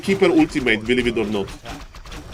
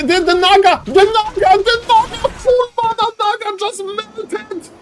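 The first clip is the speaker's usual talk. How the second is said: much higher, near-shrieking hype call; ecstatic scream. Real, not acted. Caster screaming to viewers as the Naga melts, ecstatic. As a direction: lose it completely over the kill.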